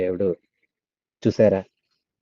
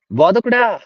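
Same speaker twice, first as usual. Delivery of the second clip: joyful cry of recognition; 9 semitones higher, slower than usual. Acted. Mary recognizes Jesus, cries out.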